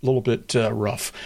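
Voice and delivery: usual speaking voice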